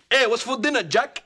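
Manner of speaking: put-on high, loud swaggering accent; Rico accent. Husband asking wife for dinner in Rico's accent. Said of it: "he's got that accent".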